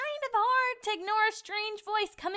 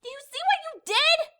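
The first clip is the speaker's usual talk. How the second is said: higher and louder, scolding the narrator; 9 semitones higher, 6 dB louder than usual. Acted. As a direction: tell him off sharply. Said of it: "She scolded".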